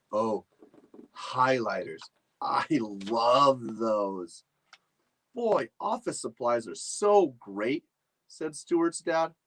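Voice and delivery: usual speaking voice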